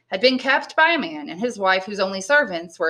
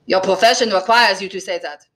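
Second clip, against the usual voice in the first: mildly bitter innkeeper; 4 dB louder than usual. A narrator's reading. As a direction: answer with a touch of bitterness. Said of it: "said Caderousse bitterly".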